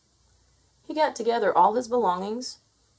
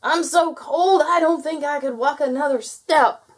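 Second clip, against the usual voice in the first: loud, high complaint; chattering gnome; 8 semitones higher, 6 dB louder than usual. A narrator's reading. Gnome too cold to walk, chattering. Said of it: "chattered the gnome".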